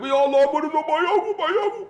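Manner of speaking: very high, loud mock wail; imitated bawling prayer. Pilgrim praying for his parents, mock wailing. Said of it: "Dia teriak-teriak".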